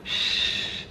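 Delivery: soft unvoiced shush; hushing. Real, not acted. Quieting the growling dog, soft whispered shush. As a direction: hush the dog softly.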